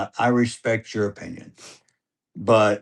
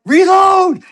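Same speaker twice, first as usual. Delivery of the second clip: much higher, much louder yell; full shout; 11 dB louder than usual. Retold or imitated. Dad yelling at his son to reload, a full shout. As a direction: bellow it across the field. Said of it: "yelling at him".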